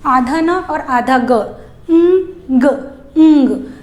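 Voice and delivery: ंग as half n plus half g, blended; slow breakdown of ंग into its parts; ng sound demo. Shown as a demonstration. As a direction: say ंग nasal, the g barely touched. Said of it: "तो इस तरह से पढ़ सकते हैं".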